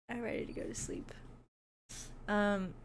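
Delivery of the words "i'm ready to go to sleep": tired whisper. Real, not acted. Tired streamer whispers that she wants to sleep. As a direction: breathe it out, worn out.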